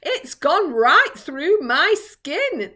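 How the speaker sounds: high, loud, slow wailing groan. A narrator's reading. Earthworm complains of rain in its skin, groaning. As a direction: moan it out in a high, drawn-out voice. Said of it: "the earthworm groaned".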